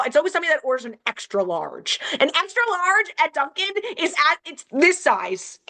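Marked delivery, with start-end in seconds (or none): none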